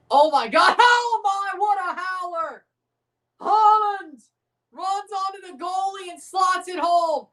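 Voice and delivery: real live excitement; excited outburst. Real, not acted. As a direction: react to the howler with glee. Reacting to a goal.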